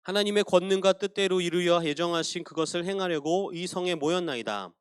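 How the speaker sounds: measured, solemn reading of the prayer; scripture reading. Acted. Pastor reading Acts prayer to the congregation, solemn reading.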